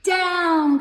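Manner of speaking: loud, high cry of Down!; witch crying out. A narrator's reading. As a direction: shout it as the hat blows away. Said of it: "cried the witch".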